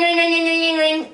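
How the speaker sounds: high, rapid trilling ring; ringing bell. Retold or imitated. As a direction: imitate the bell going off. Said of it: "And then ring".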